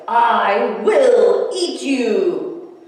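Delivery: loud, very slow stretched roar of a threat; monster threat. A narrator's reading. Theodore chases the boy through the forest, slow loud threat. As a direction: stretch every word like a chasing monster.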